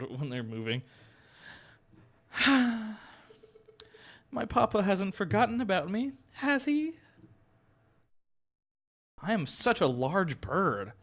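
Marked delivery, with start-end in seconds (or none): 4.3-6.9 put-on higher voice of a worried young noblewoman; a higher girlish prisoner wondering about her father; young lady voice. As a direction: pitch up into the fretful young heiress. Catherine voiced.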